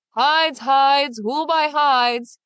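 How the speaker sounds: Big Claus cries his hides through town; bawling street cry. Acted. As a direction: bawl it like a market seller. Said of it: "he bawled up and down the streets".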